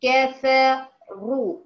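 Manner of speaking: كفروا very slow with a thick back R; تفخيم الراء. Shown as a demonstration. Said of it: "ارتفع بأقصى اللسان". (كفروا ببطء شديد وراء مفخمة)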